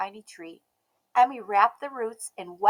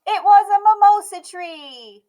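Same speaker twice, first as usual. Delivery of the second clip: excited reveal; 10 semitones higher, 14 dB louder than usual. A narrator's reading.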